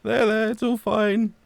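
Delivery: high, slow soothing coo. Retold or imitated. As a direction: gently comfort them, slow and high. Gently soothing a forgetful friend.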